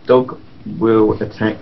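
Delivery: ordinary delivery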